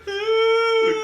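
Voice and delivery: very high, slow, loud howl; loud high ghostly dog howl; dog howl imitation.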